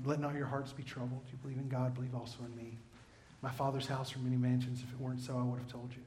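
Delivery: quiet, reassuring voice of Jesus; gentle Jesus. Acted. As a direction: speak comfort gently to frightened friends. Jesus voiced comforting his disciples.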